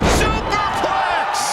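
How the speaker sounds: excited shout. Acted. Wrestling move called out.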